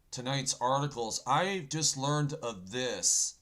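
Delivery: loud, high, pumped-up show opener; hyped-up opener. Real, not acted. Host rallying his viewers, loud and pumped. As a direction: fire up the audience to start the news. Host kicks off the news segment.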